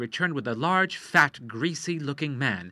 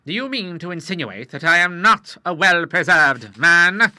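louder, higher affronted challenge; offended Admiral; 6 semitones higher, 10 dB louder than usual. Acted. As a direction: bristle at the insult.

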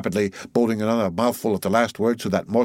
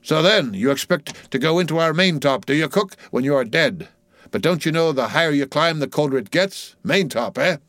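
higher-pitched mocking ship's mate; teasing mate; 7 semitones higher, 3 dB louder than usual. Mate mocking cook's idea of heaven, teasing. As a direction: tease with mock logic.

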